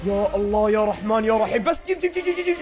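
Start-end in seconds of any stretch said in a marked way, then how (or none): 1.6-2.6 high rapid-fire urging; excited urging. Real, not acted. Gamer urging himself on, high and excited. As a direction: egg it on in a high voice.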